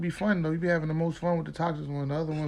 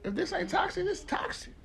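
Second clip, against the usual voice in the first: high, softer quoted voice; mock alarm; 11 semitones higher, 6 dB quieter than usual. Acts out quoted reaction, high soft voice.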